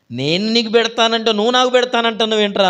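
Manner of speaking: high, loud, incredulous; can't believe the reversal; mock surprise. King baffled by the youth's request, incredulous.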